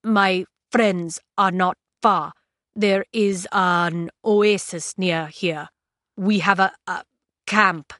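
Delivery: put-on slow, halting foreign-accented English; accented speaker groping for words. A narrator's reading. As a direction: stumble through it word by word in a thick accent. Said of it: "he stumbled over the language".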